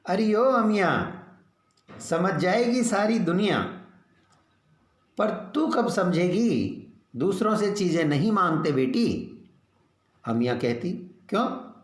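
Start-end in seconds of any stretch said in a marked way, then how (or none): 0.1-9.3 scolding mother. A narrator's reading. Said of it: "पर माँ छींकती है". 11.3-11.7 put-on sharp high childish why; a little girl's pert question; child asking why. A narrator's reading.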